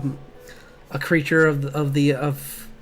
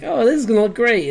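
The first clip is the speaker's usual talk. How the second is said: high-pitched, louder burst of naive delight; mock excitement; 9 semitones higher, 6 dB louder than usual. Art student admiring a project before printing, mock excitement. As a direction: act out hopeful enthusiasm, voice jumping up.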